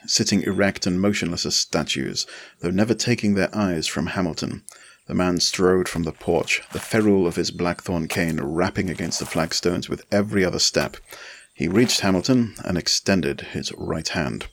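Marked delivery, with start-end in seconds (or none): none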